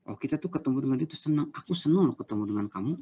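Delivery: fast, eager, happy greeting; enthusiastic. Shown as a demonstration. Teacher demoing greeting someone happily, fast and eager. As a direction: sound delighted to see them. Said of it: "Senyum dan tunjukkan semangat".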